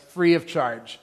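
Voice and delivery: usual speaking voice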